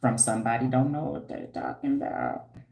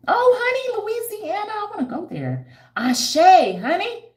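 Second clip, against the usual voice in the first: high, squealing excited greeting; delighted squeal; 6 dB louder than usual. Real, not acted. Vlogger greets a Louisiana viewer with a high delighted squeal. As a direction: shriek with joy at a viewer's message.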